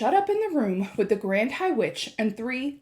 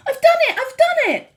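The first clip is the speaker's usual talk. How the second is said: loud, high triumphant cry; bursting with victory; cried out, jumping; 9 dB louder than usual. A narrator's reading. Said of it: "I cried, jumping up and down".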